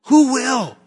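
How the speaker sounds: high-pitched rhetorical repeat; urgent challenge. Real, not acted. Preacher pressing the congregation about a broken world, urgent challenge.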